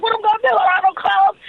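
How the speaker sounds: high, flustered rush; a giddy panic about what to pack; excited panic. Retold or imitated.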